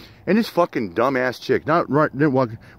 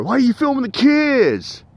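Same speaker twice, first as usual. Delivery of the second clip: mocking bystander; 13 semitones higher than usual. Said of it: "And you got people going there".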